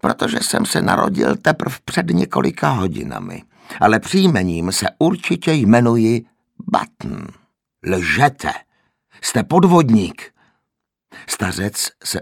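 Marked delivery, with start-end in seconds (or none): 0.0-10.3 put-on higher, louder cranky old voice; grumpy wheezing old man. A narrator's reading. The old man grumpily calls Mr Button a fraud. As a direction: snap back like a peevish old man. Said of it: "nevrle zasýpal stařec".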